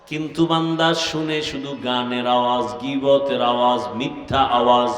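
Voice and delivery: low, sing-song preaching; preacher's solemn chant; melodic sermon recitation. Acted. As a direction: intone scripture and translation with stretched endings.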